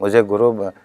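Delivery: usual speaking voice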